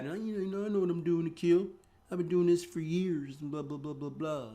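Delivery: high-pitched parody of a cocky new trader; mock overconfident newbie. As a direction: mimic a know-it-all brushing off advice, high and rattled off. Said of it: "they're talking all this ridiculous stuff".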